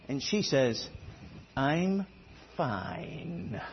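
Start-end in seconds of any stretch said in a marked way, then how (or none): 1.6-3.6 "fine" stretched slowly into two syllables, softly; hushed, loaded "I'm fine", with "fine" drawn into two beats. Retold or imitated. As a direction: quietly draw "fine" out as fi-ine. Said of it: "pronouncing a one-syllable word as a two-syllable word".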